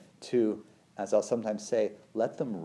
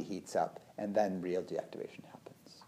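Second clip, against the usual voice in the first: soft whisper; 3 semitones lower, 8 dB quieter than usual. Real, not acted. Describing shaking and crying after danger.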